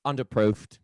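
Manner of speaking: clipped Bake Off judge British accent; British accent, one word, posh. Shown as a demonstration. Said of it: "here's my British".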